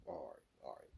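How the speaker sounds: ordinary talk, nothing marked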